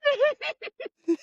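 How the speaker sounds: very high shrieked disbelief; high mock shriek of horror. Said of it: "And she's".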